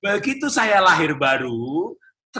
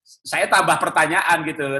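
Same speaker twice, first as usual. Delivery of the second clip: ordinary talk, nothing marked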